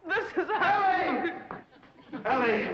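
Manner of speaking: high, slow, swooning exclamation with a laugh; lovestruck cry. Acted. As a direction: cry her name in giddy rapture. Charmed man arrives swooning.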